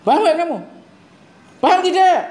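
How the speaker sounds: put-on sharp, high, loud scolding of a boy; stern demo. Shown as a demonstration. Teacher demos scolding a boy, sharp and loud. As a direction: snap at a dozing schoolboy.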